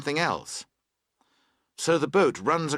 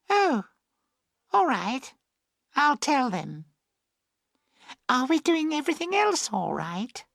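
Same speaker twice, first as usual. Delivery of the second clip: put-on high, girlish chatter; girl's voice; 13 semitones higher than usual. A narrator's reading. Emily agreeing with boatman, high girlish voice. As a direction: speak as a girl, pitched very high.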